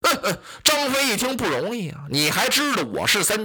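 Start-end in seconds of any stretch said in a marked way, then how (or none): none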